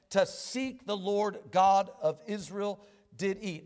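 reads the verse steadily, a touch formal; measured Bible reading; scripture reading. Acted. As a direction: read the text aloud to the congregation.

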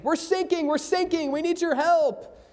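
lightly voiced frantic sailor; mock panic. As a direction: cry for help from a sinking ship. Sailors calling for help.

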